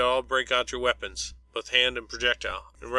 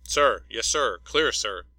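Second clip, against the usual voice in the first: barked soldier's reply, louder; shouting military voice; 6 dB louder, slower than usual. Acted. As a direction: snap it out like a recruit to a drill sergeant. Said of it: "in a shouting military voice".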